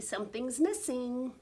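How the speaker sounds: plain reading voice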